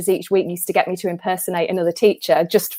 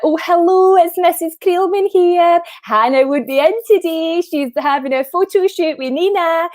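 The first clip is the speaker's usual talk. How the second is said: high, loud put-on Scottish accent; singsong Scottish phone voice, pitched well up; Scottish mum impression; 9 semitones higher, 4 dB louder than usual. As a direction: pose as a Scottish mother phoning the school. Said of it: "I'll do a Scottish accent".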